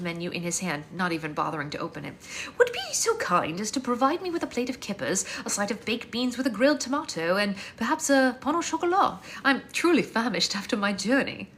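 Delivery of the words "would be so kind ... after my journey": lightly posh British prince; British accent. Acted. Prince ordering kippers from Maureen, light British accent.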